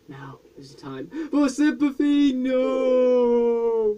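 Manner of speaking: high, slow, hammed grief; dramatic. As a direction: overact the sadness. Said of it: "Can I be dramatic yet?".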